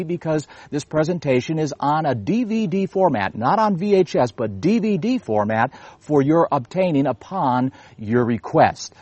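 normal talking voice

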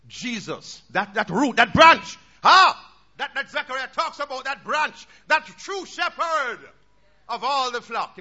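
loud, ringing pulpit voice; full-force sermon delivery; fiery preaching. Acted. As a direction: preach it out to the congregation.

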